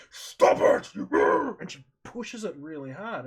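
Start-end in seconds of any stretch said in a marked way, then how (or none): 0.1-0.9 sharp, loud, breathy yell; retold shout. Owner yelling at her dog, loud breathy shout. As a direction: bark the command at the dog. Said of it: "She goes like".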